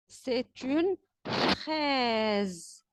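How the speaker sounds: very slow, each syllable stretched out; drawn-out model sentence; slow teaching voice. Shown as a demonstration. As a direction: lay it out slowly for the children. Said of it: "teacher models a word to repeat".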